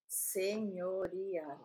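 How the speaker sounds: "señorial" slowly, syllable by syllable; pronunciation model. Shown as a demonstration. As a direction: draw out "señorial" for a learner to copy. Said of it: "Eh, sí". (señorial lento, sílaba por sílaba)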